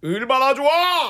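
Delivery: high, slow, loud delight; ad line impression. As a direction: draw out the joy. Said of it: "요거는 봉영 씨가 해주세요".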